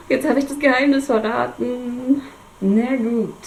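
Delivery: giggling. Real, not acted. Reader jokingly admits a spoiler.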